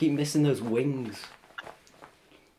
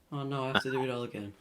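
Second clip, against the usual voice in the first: ordinary talk, nothing marked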